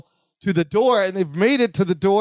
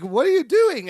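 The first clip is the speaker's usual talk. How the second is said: startled question; 8 semitones higher than usual. Retold or imitated. Balky startled by Larry.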